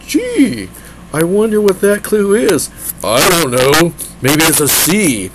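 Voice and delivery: exaggerated sarcastic wonder; mock wondering. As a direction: ham up the clueless musing. Mock wondering about obvious clue.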